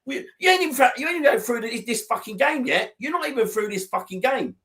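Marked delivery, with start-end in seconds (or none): none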